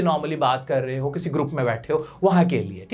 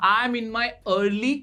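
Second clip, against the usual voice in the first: "I am in my early twenties" spoken very slowly and deliberately; slow careful model; much slower than usual. Shown as a demonstration. Teacher slowly models a phrase for hiding age. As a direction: draw out each word for learners. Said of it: "आप ऐसे बोल दो".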